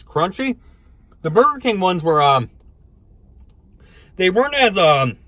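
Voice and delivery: speaking between bites; chewing while talking. Real, not acted. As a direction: mouth partly full. Seen 1: bites stick at -1.0, chews with food in mouth early on.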